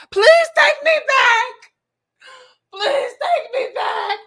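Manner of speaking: high, drawn-out wailing plea; mock-crying desperation; hammed-up begging. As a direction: wail it as if begging an ex. Acting out begging an ex.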